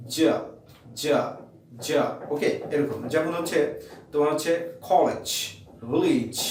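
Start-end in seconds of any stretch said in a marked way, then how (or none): 0.1-2.2 জা said with a slow buzzing release; sound demo. Shown as a demonstration. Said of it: "IPA তে বলা হয় জা". (আস্তে রিলিজ করে জা)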